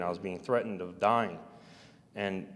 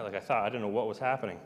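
usual speaking voice

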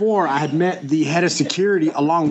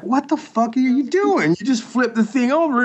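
mock angry boss; 8 semitones higher than usual. Tattoo shop owner scolding the worker for the backwards tattoo, exasperated and higher. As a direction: play the fed-up owner catching the mistake.

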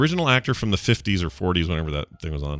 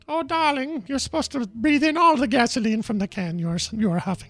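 high, affected socialite voice; darling-drawing high society voice; mock Ariana Huffington. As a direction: purr it like a grand lady, way up high.

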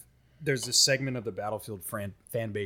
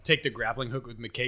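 normal talking voice

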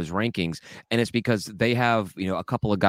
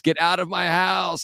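retold yell; 10 semitones higher, slower than usual. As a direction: imitate a yell from bed without real volume. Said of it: "was in bed yelling at us".

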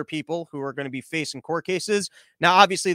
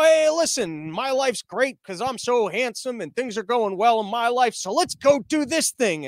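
slow, higher-pitched mock cool-guy pitch; cocky alpha dude; 5 semitones higher, slower than usual. Mock FBI agent recruiting losers, cocky alpha dude. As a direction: swagger lazily through it like a smug stud. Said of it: "they're all cool, good-looking alpha dudes".

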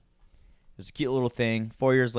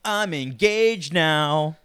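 slow, loud proud reveal; excited announcement; 4 semitones higher, 7 dB louder, much slower than usual. Real, not acted. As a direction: drag out the big news. Big announcement.

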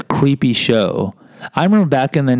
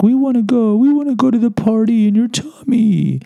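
much higher, squeaky vegetable voices; crying vegetables; 13 semitones higher than usual. Retold or imitated. Uneaten vegetables begging to be eaten, high and whiny. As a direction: whine it up high like tiny veggies. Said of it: "they're crying like".

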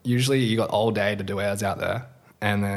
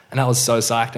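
ordinary talk, nothing marked

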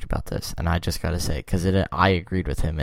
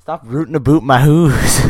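loud, higher cartoon Canadian accent; mock Canadian accent; 6 semitones higher, 7 dB louder than usual. Canada shooing off invaders, mock Canadian accent. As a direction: exaggerate Canadian oo vowels, indignantly. Joking stereotyped Canadian retort.

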